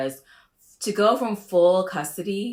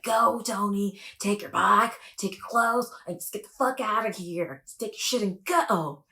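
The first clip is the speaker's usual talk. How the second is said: higher, sassy kicking him out; imitating Angela; 5 semitones higher than usual. Mocking Angela throwing Tony out, sassy and higher. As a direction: shoo him out with attitude. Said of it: "She's like".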